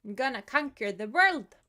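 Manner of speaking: loud gloating villain boast; sneering panto villain. Pretend. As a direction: crow it like a cartoon villain. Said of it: "and think".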